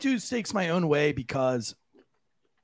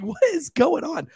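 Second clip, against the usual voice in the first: much higher, puzzled outcry; bewildered; 12 semitones higher than usual. Retold or imitated. Retelling her shock at a dinner fight, high bewildered cry. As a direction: act stunned by the scene. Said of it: "I was like".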